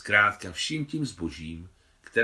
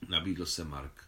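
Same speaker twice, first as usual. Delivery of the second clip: ordinary delivery, nothing marked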